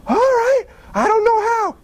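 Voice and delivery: high-pitched, unsure voice; hesitant childlike agreement. Acted. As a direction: agree in a high voice, acting out self-doubt.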